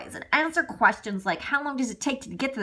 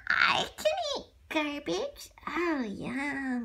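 ordinary delivery

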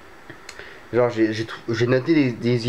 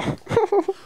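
high laugh. Real, not acted. Laugh after his mock panic.

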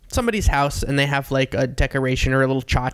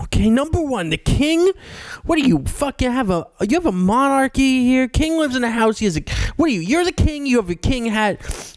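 high-pitched, hammed-up drunken lecturing; mock drunk American, indignant and pitched up; drunk student; 6 semitones higher than usual. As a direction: argue like a wasted student abroad. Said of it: "absolutely fucking wasted out of his mind".

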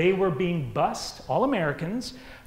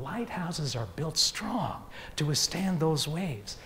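ordinary delivery

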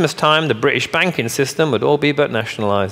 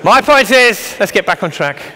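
high, loud push over the laughter; calling for attention; 8 semitones higher, 6 dB louder than usual. Real, not acted. Speaker to laughing audience, getting back on track, calling out. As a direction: pull the room back, raised voice. Regaining the room after laughter.

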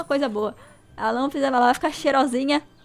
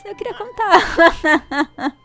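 laughing; 6 semitones higher, 5 dB louder, much slower than usual. Real, not acted. Disappointed she already told.

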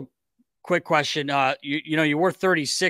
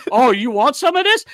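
mock challenge; 9 semitones higher, 6 dB louder than usual. Teammate challenging him to dance off.

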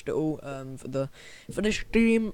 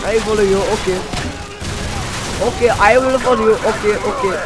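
louder, eager mock compliance; mock obedient soldier; 5 semitones higher, 4 dB louder than usual. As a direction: answer like a keen recruit. Answering game character.

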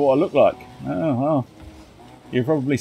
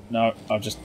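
usual speaking voice

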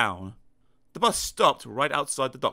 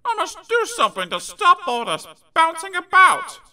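small high character voice; 7 dB louder than usual. Acted. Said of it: "he thought".

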